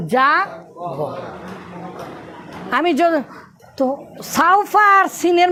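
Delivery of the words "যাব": slow stretched word. Shown as a demonstration. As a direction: stretch যাব to show the far future. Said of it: "মানে আমি অচিরেই". (যাব শব্দটা টেনে ধীরে বলা)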